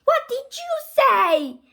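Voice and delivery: high, loud question; calling out. Acted. Mom asking from another room, loud high call. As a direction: shout the question across the house.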